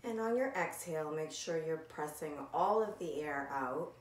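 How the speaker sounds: calm, softened meditation guide voice; gentle, unhurried breathing instructions with long pauses; guided meditation. Acted. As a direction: ease them into the breath, leaving long silences. Leads a body scan meditation.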